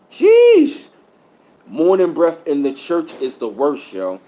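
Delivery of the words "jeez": high disgusted yelp. Retold or imitated. Reacting to stinky breath.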